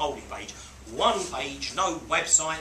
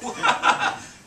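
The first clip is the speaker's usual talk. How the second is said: short breathy laugh; real amused laugh; laughing at his own reply; 8 dB louder than usual. Real, not acted.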